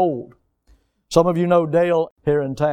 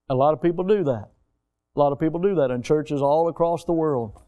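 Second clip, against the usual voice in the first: normal talking voice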